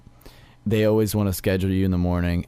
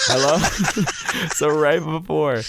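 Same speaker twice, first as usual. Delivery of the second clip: higher, cheerful hello; bright phone hello; 7 semitones higher than usual. Shown as a demonstration. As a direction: fake being wide awake. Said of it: "I'll be like".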